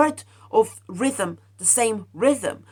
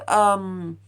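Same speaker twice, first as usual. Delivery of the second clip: normal talking voice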